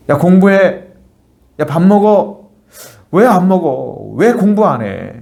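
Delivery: nagging mother. Retold or imitated. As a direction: nag the kid like an exasperated mother. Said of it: "우리 어머님께서 우리 자녀들에게 잔소리하죠".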